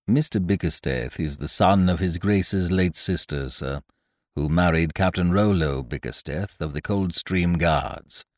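a hint of a low, soft, deferential valet; lightly voiced butler, quiet and proper; Jeeves the butler. A narrator's reading. Butler answering his master, low and deferential.

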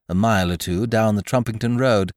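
usual speaking voice

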